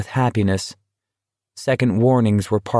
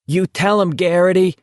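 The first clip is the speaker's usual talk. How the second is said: yelled encouragement; 8 semitones higher than usual. Acted. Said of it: "someone else yelled".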